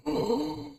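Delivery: high sudden gasp; sharp gasp. Real, not acted. Startled while trying to upload, sharp high gasp. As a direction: gasp in surprise.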